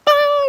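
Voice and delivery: high mimicked warning chime; ping it out like a dashboard alert; imitating the car's fuel warning bong.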